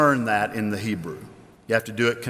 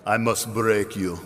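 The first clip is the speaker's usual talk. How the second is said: low-pitched Russian fighter quote; deep movie-villain line; movie Russian boxer; 5 semitones lower than usual. Retold or imitated. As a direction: imitate a huge, menacing opponent. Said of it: "this huge Russian".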